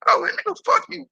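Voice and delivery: high-pitched, heated brush-off; scornful refusal. Retold or imitated. Man retelling his answer to the pleading intruder, scornful refusal.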